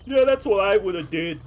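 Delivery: put-on loud, high New York Italian accent; a Brooklyn Italian tough guy talking. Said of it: "a thick Italian New York accent".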